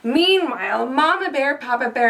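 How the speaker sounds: ordinary delivery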